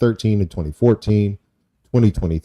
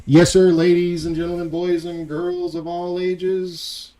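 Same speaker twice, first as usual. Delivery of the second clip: ringmaster; 9 semitones higher than usual. Pretend. Showman announcing the guest.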